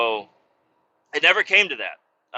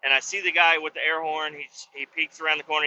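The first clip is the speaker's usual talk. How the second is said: usual speaking voice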